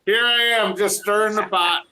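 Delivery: ordinary talk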